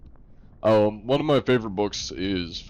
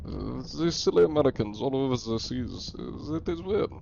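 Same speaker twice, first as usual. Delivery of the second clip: higher-pitched, put-on German accent; hammed-up German complainer, pitched up; 7 semitones higher than usual. German grumbling about silly Americans at sea, comic German accent. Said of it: "borderline doing the German accent".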